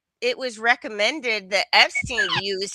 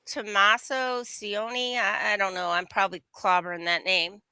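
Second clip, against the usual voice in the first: ordinary delivery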